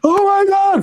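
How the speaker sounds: high, loud panicked cry. Retold or imitated. Investor panicking over lost money, loud high cry. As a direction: shriek it like someone freaking out. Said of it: "they were breaking down".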